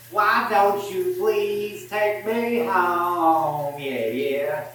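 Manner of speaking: lightly sung country twang, slow; a hammed-up North Carolina drawl, half singing; country accent. Singing to Amanda in a teasing country accent. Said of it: "I know how much you hate it when I do this accent".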